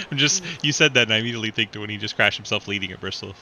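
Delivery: talking through real laughter; words spilling out mid-laugh. Real, not acted. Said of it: "I'm trying not to laugh".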